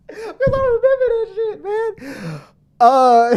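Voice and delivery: high, loud laughter breaking through the words; can barely get it out for laughing. Real, not acted. Laughing while retelling the story.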